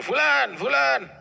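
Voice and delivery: high, loud call for his son; shouting a name. Acted. Man searching for his siwak calls his son, loud high shout. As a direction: yell the name across the house. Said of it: "Terus dia teriak, panggil anaknya".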